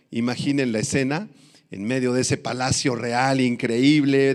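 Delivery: ordinary delivery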